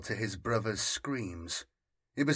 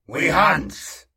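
in unison; 6 semitones higher, 10 dB louder than usual. A narrator's reading. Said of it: "Uzzaz and Zal said at once".

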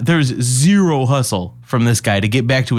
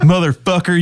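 higher-pitched Southern swearing; 6 semitones higher, 3 dB louder than usual. Acted. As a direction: lay on a thick Southern accent. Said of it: "like, way Southern".

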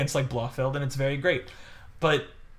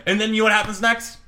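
ordinary talk, nothing marked

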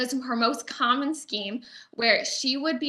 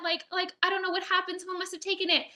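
much higher, fast, hammed-up flustered apology; mock apologetic girl; 8 semitones higher, 4 dB quieter, faster than usual. Young member apologizing to the gentleman, hammed-up flustered. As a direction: gush sorry breathlessly. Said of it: "And she's like".

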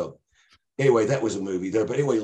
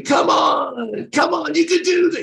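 much higher, loud cheering shout; Ray cheering; 8 dB louder, slower than usual. Retold or imitated. Friend cheers drunk driver through sobriety test, high shout. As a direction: egg him on like a wild Goodfellas buddy.

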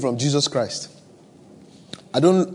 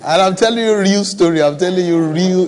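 raised voice, insisting it's real; louder insistence; 5 semitones higher, 8 dB louder than usual. Real, not acted. Preacher insisting to a laughing congregation, louder. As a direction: press it home over the laughter. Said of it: "What you're laughing at was not funny".

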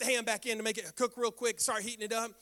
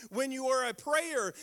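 ordinary delivery

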